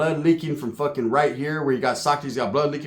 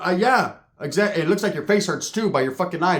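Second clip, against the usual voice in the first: normal talking voice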